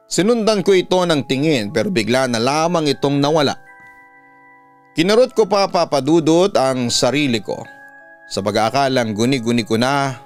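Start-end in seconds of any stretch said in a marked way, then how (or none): none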